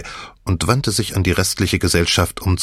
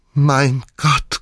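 higher, gasped mild horror; gasping in shock; 6 semitones higher than usual. A narrator's reading. Misses Croft caught by the ghost, gasping mildly in shock. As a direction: gasp it out, caught out. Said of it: "keuchte Misses Croft".

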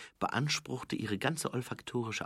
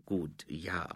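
reluctant, softer answer; slow hesitant reply; 2 semitones lower, 6 dB quieter than usual. A narrator's reading. Angua answering Nobby's unexpected answer, slow and hesitant. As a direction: concede it slowly, with a pause. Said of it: "erwiderte Angua langsam".

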